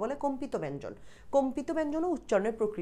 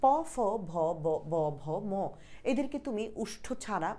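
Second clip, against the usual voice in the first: ordinary delivery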